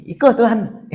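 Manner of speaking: high-pitched scoffing in Minang; Minang-style mockery. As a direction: scoff at it, voice jumping up.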